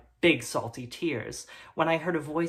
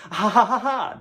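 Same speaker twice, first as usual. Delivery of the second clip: high, loud jeering ha ha; mocking laughter; 6 semitones higher, 8 dB louder than usual. Boys jeering at the narrator with mocking laughter. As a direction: laugh in the new kid's face. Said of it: "they laughed".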